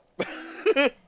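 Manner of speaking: sudden loud high burst of laughter. Real, not acted. Gamer laughing as the character rolls up, loud laugh. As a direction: crack up triumphantly.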